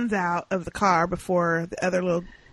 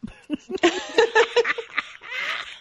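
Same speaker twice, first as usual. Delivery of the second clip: delighted laughter. Real, not acted. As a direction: burst out cackling with glee. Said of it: "I loved when he said".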